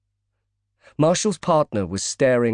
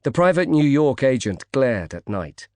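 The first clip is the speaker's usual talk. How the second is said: ordinary delivery, nothing marked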